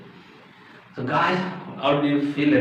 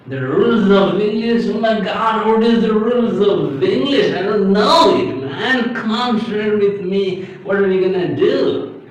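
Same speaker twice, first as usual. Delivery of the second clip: loud, high, hammed-up alarm; mock panic; 7 semitones higher, 5 dB louder than usual. Teacher playing a clueless learner asking about rules, mock panic. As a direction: cry out as if pretending to be lost.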